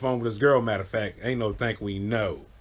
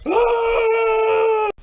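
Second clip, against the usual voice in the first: a sudden high shriek; high scream; 5 dB louder than usual. Retold or imitated. Scared friend in the dark, high scream. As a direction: shriek in panic.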